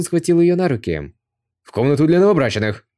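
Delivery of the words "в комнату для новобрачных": louder triumphant call to the troops; prince's loud triumphant shout. A narrator's reading. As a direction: bellow it as he carries her off. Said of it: "Чао Цзун громко крикнул".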